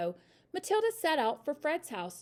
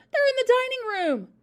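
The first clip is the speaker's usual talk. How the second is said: high, loud, urgent warning; panicked stage hiss with full voice; alarmed hiss; 13 semitones higher, 9 dB louder than usual. A narrator's reading. Mother locating burglars for family, urgent alarm. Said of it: "hissed the mother".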